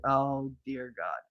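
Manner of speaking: slow, soft dismay; sinking dread. Retold or imitated. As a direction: say it low and slow, as dread sinks in. Dread on realizing the video.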